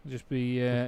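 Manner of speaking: usual speaking voice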